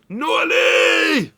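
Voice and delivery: very high, loud, drawn-out holler; full-throated shout to someone far off; shouted call. Retold or imitated. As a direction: bellow the name across the ground, holding it long. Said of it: "he shouts".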